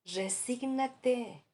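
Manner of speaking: "Resígnate" agudo, muy lento y más fuerte; cliché burlón. Retold or imitated. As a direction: estira la palabra imitando a un consolador torpe. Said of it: "Se defiende porque no sabe consolar". (mandato agudo y arrastrado)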